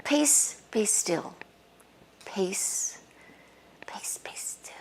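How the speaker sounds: soft words sinking into a whisper; fading to a whisper. Shown as a demonstration. Showing meditators how to calm a thought, fading to a whisper. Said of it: "just go".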